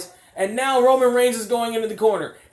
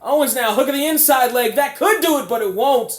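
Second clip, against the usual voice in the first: higher, louder near-fall call; excited commentary; 5 semitones higher, 4 dB louder than usual. Real, not acted. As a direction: hype the pin attempt. Calling a near pin.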